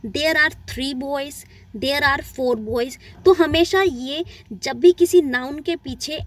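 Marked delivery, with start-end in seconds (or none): 0.1-3.0 put-on there are three boys dragged out word by word; slow dictation pace; very slow example. Shown as a demonstration. Teacher slowly reads plural examples to class. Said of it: "It means".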